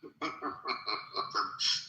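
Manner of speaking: laughing. Real, not acted. Amused at a rival's question about war, laughing. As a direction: chuckle to yourself at the point.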